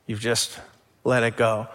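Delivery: usual speaking voice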